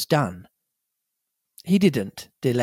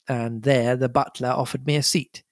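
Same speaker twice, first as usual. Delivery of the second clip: ordinary delivery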